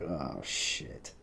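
sinking realization under the breath; dismayed mutter. Retold or imitated. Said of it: "And I'm like".

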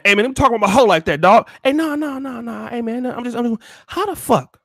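put-on puffed-up boyfriend snapping back; angry Joseph. Joseph confronting Percy about Mary, mock angry. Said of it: "he get mad".

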